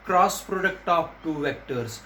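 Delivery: very slow, word by word; slow dictation. Shown as a demonstration. Teacher dictating the term slowly to students. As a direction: spell it out slowly for the class. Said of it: "teacher slowly dictates key phrase".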